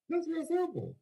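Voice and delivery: That's real simple sung out high and stretched; high emphatic repeat. Real, not acted. Pitching the plan to the crowd, high emphatic repeat. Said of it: "repeated higher".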